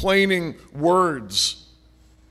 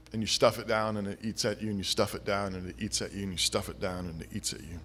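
each repeat softer and lower until almost gone; fading repetition; 9 semitones lower, 13 dB quieter, faster than usual. Acted. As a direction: let it sink away like a weight pressing down. Repeated phrase dying away.

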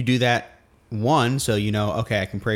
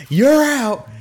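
high, loud referee-style call; full-voiced mock yell; demonstrated yell; 13 semitones higher, 8 dB louder than usual. Shown as a demonstration. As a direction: holler it at the kids in play. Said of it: "I say it the exact same tone".

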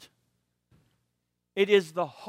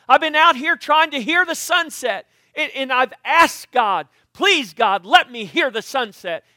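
loud, exasperated rant, higher and louder; mock frustration; 6 semitones higher, 10 dB louder than usual. Pastor acting out his frustration to the congregation, loud and exasperated. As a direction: vent like a man fed up for years.

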